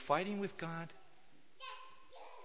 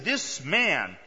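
THIS MAN hit hard and loud; emphatic; 7 dB louder than usual. Acted. God correcting Abraham, emphatic on "This man". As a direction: punch out "This man" forcefully.

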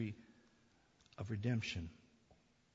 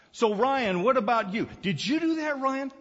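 father's loud demand; 12 semitones higher, 10 dB louder than usual. Retold or imitated. Father questioning little son.

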